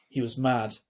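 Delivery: ordinary delivery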